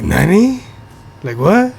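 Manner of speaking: high-pitched mock disbelief; stunned, sharply rising pitch; shocked. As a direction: squeak it out as a baffled question. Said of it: "so fucking shocked".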